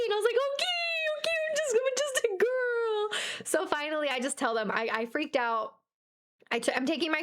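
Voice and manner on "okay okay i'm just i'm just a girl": very high, stretched-out whine; squealed, drawn-out comic protest; mock freaking out. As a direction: plead helplessly in hammed-up distress. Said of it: "I'm freaking out".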